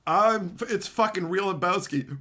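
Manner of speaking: ordinary delivery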